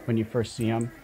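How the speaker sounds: ordinary talk, nothing marked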